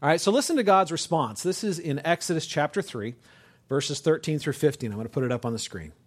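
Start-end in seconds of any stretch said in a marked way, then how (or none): none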